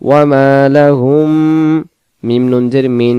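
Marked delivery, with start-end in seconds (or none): 0.1-1.3 Quran recitation. Shown as a demonstration. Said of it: "হা মিম পেশ হুম".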